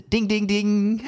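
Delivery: high, drawn-out "ding, ding, ding"; imitated round bell. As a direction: mimic a boxing bell between rounds. Imitiert die Glocke zur Runde.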